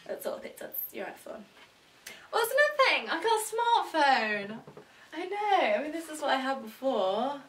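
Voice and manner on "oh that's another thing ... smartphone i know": high, loud burst of excitement; excited announcement. Real, not acted. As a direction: announce it loudly, stretching the last words. Announcing new phone with big fanfare.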